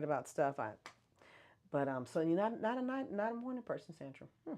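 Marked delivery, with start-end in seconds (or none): none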